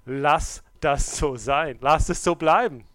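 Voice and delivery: loud, rising exhortation; preaching at full force. Acted. Preacher urging congregation to live the truth, building loud.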